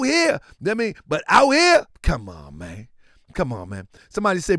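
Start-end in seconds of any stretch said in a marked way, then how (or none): none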